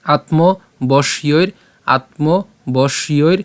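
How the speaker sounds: আত্মবশ্যৈর very slow, every syllable clear; slow pronunciation demo. Shown as a demonstration. Teacher showing the class how to say আত্মবশ্যৈর, very slowly. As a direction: pull আত্মবশ্যৈর out slowly twice. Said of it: "উচ্চারণটা এইভাবে হচ্ছে".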